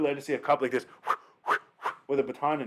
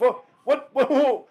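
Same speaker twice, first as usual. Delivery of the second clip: high, loud startled exclamations; hammed-up alarm; retold shock; 12 semitones higher, 6 dB louder than usual. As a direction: act out a sudden fright at what is coming. Retold shock at seeing cop charging.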